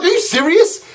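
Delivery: high, rising incredulous question; retold protest, pitch jumping up; indignant disbelief. Man challenging the airline agent over a fee, incredulous.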